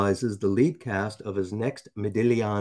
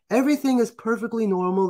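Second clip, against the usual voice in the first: higher-pitched, incredulous ranting; mocking exasperation; 11 semitones higher than usual. Real, not acted. Host ranting about news readers' accents, exasperated mockery. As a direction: rant at the absurdity, voice pitched up.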